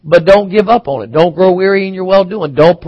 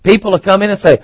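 usual speaking voice

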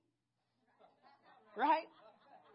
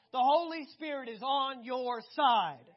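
emphatic proclamation; 10 dB louder than usual. Real, not acted. Preacher proclaims slowly, loudly.

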